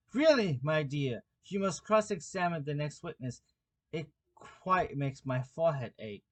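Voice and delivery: ordinary delivery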